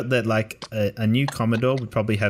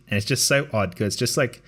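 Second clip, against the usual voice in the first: usual speaking voice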